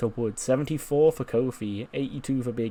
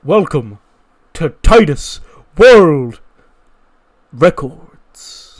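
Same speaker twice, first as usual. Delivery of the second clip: slow, booming announcer voice, much louder; hype announcer; 3 semitones higher, 15 dB louder, much slower than usual. Acted. Announcing the hype video's opening title, loud and slow. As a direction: stretch it out like an arena intro.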